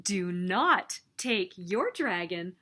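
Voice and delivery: plain reading voice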